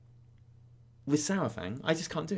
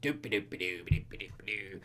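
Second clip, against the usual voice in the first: goblin scat; 8 semitones lower, 6 dB quieter than usual. Retold or imitated. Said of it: "my New York accent suddenly left me there".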